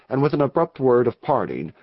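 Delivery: ordinary delivery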